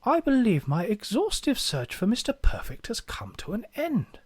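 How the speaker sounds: hushed documentary-narrator whisper; breathy hush, lifting in pitch; David Attenborough. Acted. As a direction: whisper it like a wildlife presenter. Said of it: "his best David Attenborough impression as he whispers".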